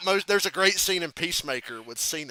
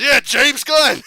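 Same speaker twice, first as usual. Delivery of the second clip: slow, loud, higher drawn-out shout; mock yell; 9 semitones higher, 13 dB louder, much slower than usual. Cena yelling a name at the guy, slow loud shout. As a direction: stretch each word out, yelling. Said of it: "Then he says".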